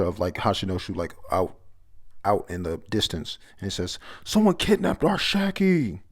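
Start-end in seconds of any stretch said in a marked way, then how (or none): 4.2-6.0 high, loud cry of alarm; acted scream. Pirates crying out that Shaki was taken, acted screaming. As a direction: call it out like a panicked crowd. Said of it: "They're all screaming".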